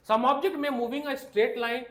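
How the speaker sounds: reading the NCERT line aloud word by word; drawn-out dictation to the class; slow textbook reading. Shown as a demonstration. Teacher reading the textbook line slowly to students.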